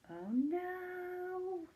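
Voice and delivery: high, hushed oh no before a sneeze; a thrilled little gasp of worry for the kids; mock dread. Sneeze about to happen.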